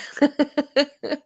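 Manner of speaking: high giggling laugh; real laugh. Real, not acted. Streamer amused by the super chat wordplay, giggling. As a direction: giggle high after your own joke.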